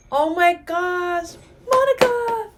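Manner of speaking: high, loud, drawn-out exclamation; excited cry. Real, not acted. Fan seeing Monica on screen, crying out excitedly. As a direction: cry out slowly in thrilled amazement. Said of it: "Sorry, I'm excited, guys".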